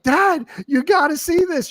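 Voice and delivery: put-on high, excited young girl calling out; excited daughter. Daughter calling her father to the camera screen, excited. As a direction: pitch way up as the thrilled daughter. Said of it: "she's like".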